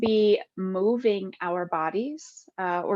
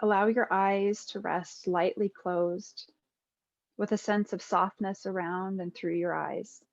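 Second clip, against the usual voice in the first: guided meditation; 4 dB quieter than usual. Acted. Yoga teacher guiding listeners lying with eyes closed, calm meditation voice. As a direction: ease them into stillness, phrase by unhurried phrase.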